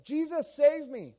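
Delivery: high, loud panicked scream for help; crying out. Acted. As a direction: cry out as if sinking. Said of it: "then he cries out".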